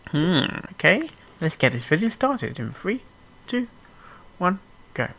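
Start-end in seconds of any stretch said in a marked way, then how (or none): none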